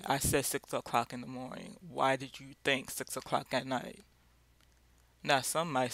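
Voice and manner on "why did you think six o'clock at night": ordinary talk, nothing marked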